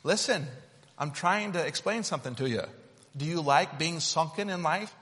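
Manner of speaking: ordinary talk, nothing marked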